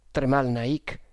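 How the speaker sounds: ordinary delivery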